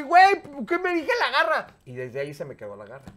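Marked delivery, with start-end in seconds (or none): 0.0-1.7 laughing disbelief. Retold or imitated. Laughing at himself after call.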